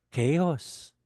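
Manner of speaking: ordinary talk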